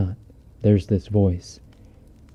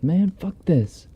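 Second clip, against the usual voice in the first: much higher, slow drawn-out delivery; retold fed-up cousin; 10 semitones higher, slower than usual. Fed-up cousin before walking out with his rifle, high and drawn out. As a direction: stretch it out high, as a quoted outburst. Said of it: "Reese says".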